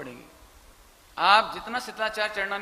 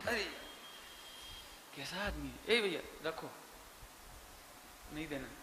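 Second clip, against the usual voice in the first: disgusted muttering; 4 semitones lower, 12 dB quieter than usual. Retold or imitated. Host catching guest stealing sweet.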